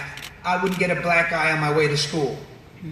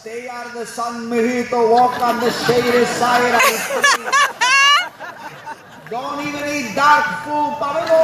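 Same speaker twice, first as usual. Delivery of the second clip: imitated grandfather; 6 semitones higher than usual. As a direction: plead with a grandson, voice climbing. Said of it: "my gramp, seeing me struggle".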